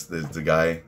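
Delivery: ordinary talk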